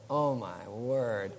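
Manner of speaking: slow, dazed mock disbelief; drawn-out, dumbfounded aside; stunned. Husband hearing the greenhouse plan, slow stunned disbelief.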